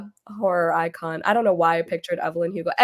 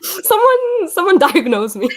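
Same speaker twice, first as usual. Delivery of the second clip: mock plea; 11 semitones higher, 10 dB louder, slower than usual. Mock plea about being atheist.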